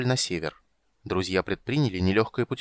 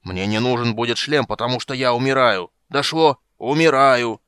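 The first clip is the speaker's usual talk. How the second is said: louder and louder furious yell; angry shout; 2 semitones higher, 7 dB louder than usual. Acted. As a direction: bellow it in exasperation. Furious dying dwarf yells.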